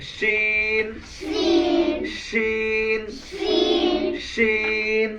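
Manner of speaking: শিন with a heavy "sh" and long vowel; thick shin. Shown as a demonstration. Teacher drilling the letter, thick drawn-out শিন. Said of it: "এটা মোটা".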